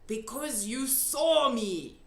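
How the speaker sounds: lightly voiced gentle giant; big friendly giant. A narrator's reading. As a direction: answer slowly like a kindly giant. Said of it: "the big friendly giant answered".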